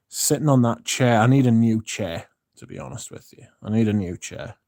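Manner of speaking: ordinary talk, nothing marked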